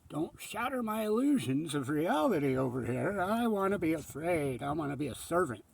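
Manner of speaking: high, whiny mock pleading; hammed-up plea, voice pushed up; mocking naysayer voice. Mimics people clinging to their illusions.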